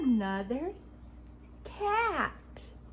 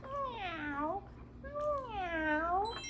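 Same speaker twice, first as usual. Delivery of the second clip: cat meow; 7 semitones higher, 5 dB quieter than usual. Pretend. Acting cat.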